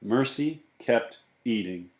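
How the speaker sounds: plain reading voice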